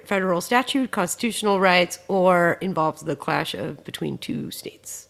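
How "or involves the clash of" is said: normal talking voice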